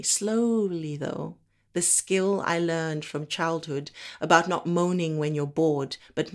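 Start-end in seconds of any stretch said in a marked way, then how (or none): 0.0-1.0 "slowly" stretched right out; drawn-out slowness. Acted. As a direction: take twice as long over "slowly". Stretches the word to illustrate it.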